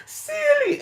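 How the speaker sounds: high, crying movie quote; imitating Color Purple cry. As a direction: wail it like the movie scene. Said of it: "She was like".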